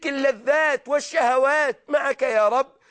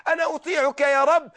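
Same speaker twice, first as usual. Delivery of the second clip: ordinary talk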